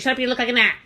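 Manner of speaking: loud, high mock insult; mocking Angelica. Vlogger mimics Angelica telling Jason to shut up, loud high mock. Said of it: "She's like".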